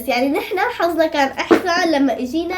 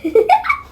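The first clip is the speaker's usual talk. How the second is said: sudden squealing laugh; high laugh; 4 dB louder than usual. Real, not acted. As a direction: burst into a high giggle. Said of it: "شو عم تحكي؟".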